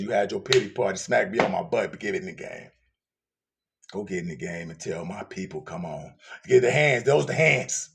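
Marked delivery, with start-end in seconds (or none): 0.0-6.1 hushed divine voice. Acted. God's voice, hushed low.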